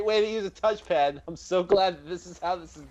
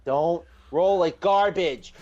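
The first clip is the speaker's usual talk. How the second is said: slow pleading; much slower than usual. Real, not acted. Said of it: "pleading with dice".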